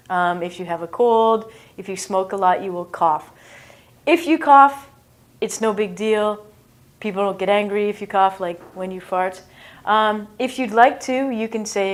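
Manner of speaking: normal talking voice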